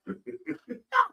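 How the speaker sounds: high-pitched, soft burst of laughter. Real, not acted. Co-host cuts in with a question, high amused laugh. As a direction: let out a high, light laugh.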